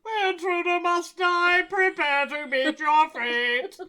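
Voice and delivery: loud menacing robot; slow booming robot threat. Pretend. Voicing the robot again.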